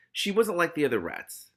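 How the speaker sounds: ordinary delivery, nothing marked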